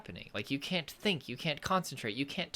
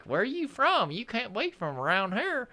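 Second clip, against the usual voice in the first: much higher, louder Southern mechanic voice; Southern local accent; 10 semitones higher, 5 dB louder than usual. Retold or imitated. Gas station worker in the South doubting where she's from, Southern accent. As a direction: play the surprised Southern gas station guy. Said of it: "and they're like".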